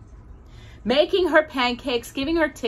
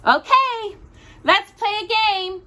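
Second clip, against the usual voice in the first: put-on much higher, eager child voice; little girl Daisy; 11 semitones higher, 4 dB louder than usual. A narrator's reading. Daisy starting a game with Josephine, high and eager. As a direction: bounce into the game.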